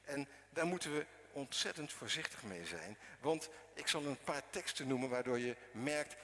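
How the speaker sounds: ordinary delivery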